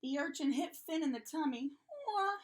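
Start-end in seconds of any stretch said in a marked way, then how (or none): 2.1-2.4 high, loud "wah"; acted cry of a hurt little one; yelled baby wail. A narrator's reading. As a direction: bawl like a toddler hit in the tummy. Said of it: "he yelled".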